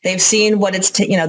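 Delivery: normal talking voice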